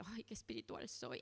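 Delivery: imitación burlona de falsa piedad, más suave; burla piadosa. Retold or imitated. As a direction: presumir de espiritual en tono de mofa. Burla de alarde espiritual. (burlándose de quien alardea de espiritualidad)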